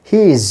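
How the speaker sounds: "he is" run together as "heez", with a long EE; strong form. Shown as a demonstration. Teacher points learners to the screen, strong form "he is" as heez. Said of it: "মূলত এটার উচ্চারণ হচ্ছে".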